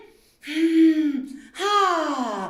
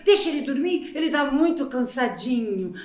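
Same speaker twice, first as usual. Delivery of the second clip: ordinary delivery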